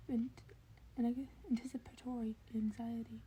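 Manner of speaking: ordinary delivery, nothing marked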